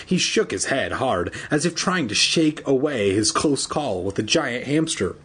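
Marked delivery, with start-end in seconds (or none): none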